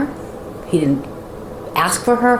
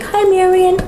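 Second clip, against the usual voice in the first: cheery husband voice; 14 semitones higher, 4 dB louder than usual. Retold or imitated. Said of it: "He's like".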